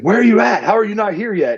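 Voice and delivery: impatient partner. Retold or imitated. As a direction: needle the slowpoke. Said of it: "He's like".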